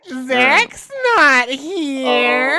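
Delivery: slow loud high distorted demon. Pretend. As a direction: drag it out like a possessed thing. Host plays possessing demon.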